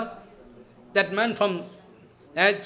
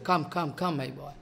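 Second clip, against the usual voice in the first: soft, lower, fading invitation; gentle welcome; 5 semitones lower, 8 dB quieter than usual. Retold or imitated. Guru welcoming a fallen disciple, quiet tender invitation. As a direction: beckon him in quietly like a kind elder. Guru's welcome voiced softly.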